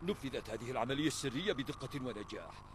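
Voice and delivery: ordinary delivery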